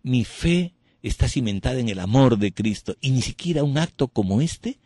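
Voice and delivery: impassioned retort to the priest; fervent testimony. Retold or imitated. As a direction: proclaim your faith. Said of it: "le dije".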